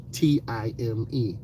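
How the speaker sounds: ordinary talk, nothing marked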